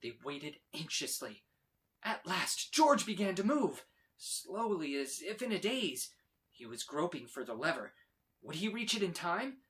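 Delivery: plain reading voice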